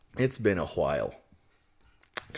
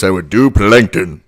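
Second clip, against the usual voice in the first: louder, slow villain voice rising higher; Plankton voice; 7 semitones higher, 8 dB louder, much slower than usual. Shown as a demonstration. Plankton as a superhero, slow loud villain voice. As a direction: make it diabolical, slow and building. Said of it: "If I was going to do a superhero voice".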